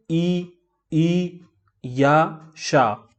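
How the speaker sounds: ई drawn out, then या and शा from the palate; palatal vowel demo. Shown as a demonstration. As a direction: stretch ई, ई before या and शा. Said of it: "ये सारे word आप देख रहे हैं". (तालु से ई, या, शा का उच्चारण)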